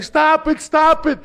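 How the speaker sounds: high, loud imitated plea; mother screaming. Retelling the mother begging the cop to stop, high imitated scream. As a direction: shriek it like a frantic mother.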